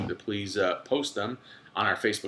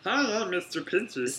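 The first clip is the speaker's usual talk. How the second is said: high, slow cartoon voice; slow goofy cartoon dad; Homer Simpson impression; 9 semitones higher, 3 dB quieter, slower than usual. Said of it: "Homer Simpson used to have a lobster".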